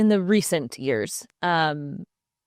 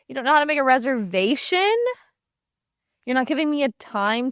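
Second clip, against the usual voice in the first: mock disbelief; 7 semitones higher, faster than usual.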